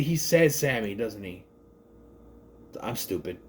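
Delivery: ordinary delivery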